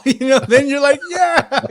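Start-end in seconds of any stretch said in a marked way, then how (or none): 1.1-1.7 high, loud answering shout; fired-up cheer. Acted. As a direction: answer the battle cry, pumped up. Fired-up answer to rally cry.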